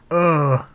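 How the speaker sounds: loud annoyed ugh; frustrated groan. Real, not acted. Frustrated after a misread.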